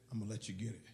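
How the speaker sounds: very low, hushed murmur; retold whisper. Wrestler secretly yielding to his tired rival, retold whisper. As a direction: drop right down as if into his ear. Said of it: "I whispered in his ear".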